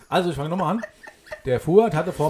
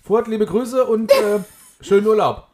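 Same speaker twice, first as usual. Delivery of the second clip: slow, loud shout-out, higher; calling out greeting; 5 semitones higher, 8 dB louder, slower than usual. Real, not acted. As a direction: call the greeting out slowly. Greeting the hairdresser on podcast.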